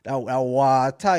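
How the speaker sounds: slow, drawn-out "water" as "waah-ta"; island-style "water". Shown as a demonstration. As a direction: stretch "water" open, dropping the r. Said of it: "certain things I have to say".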